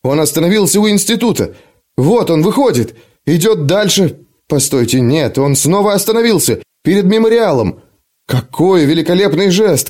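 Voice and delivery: lightly voiced eager young man; rising excitement watching the envoy; a hint of breathless commentary; excited commentary.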